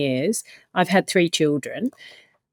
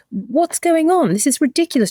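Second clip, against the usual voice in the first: retold outraged disbelief; 9 semitones higher than usual. Friend telling me off over the tablets, indignant disbelief. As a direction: mimic her exasperated outburst. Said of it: "She said".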